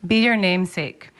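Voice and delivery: ordinary delivery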